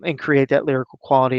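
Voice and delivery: ordinary talk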